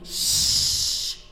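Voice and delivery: shushing whisper, mostly breath; hushing whisper. Acted. Crowd hushing the blind beggar, whispered. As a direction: hiss them into silence.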